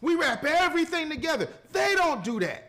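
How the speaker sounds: emphatic. Real, not acted. Contrasting us and them, emphatic.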